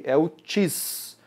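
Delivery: "tis" said very slowly and leaned on; stressed syllable drawn out. Shown as a demonstration. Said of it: "Qual é a sílaba tônica aí?".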